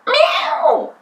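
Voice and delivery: high, loud yowling meow; a startled cat yowl; cat cry. A narrator's reading. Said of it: "cried Wilbur".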